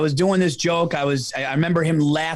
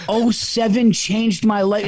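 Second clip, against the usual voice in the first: mock-embarrassed replay; 5 semitones higher, 3 dB louder than usual. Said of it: "I have children".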